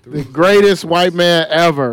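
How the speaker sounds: mock proclamation. Echoing the joke loudly.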